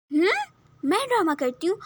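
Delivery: a high, loud offended yelp of disbelief; taken aback and offended; indignant surprise. Acted. Bride offended when told she cries for drama, indignant.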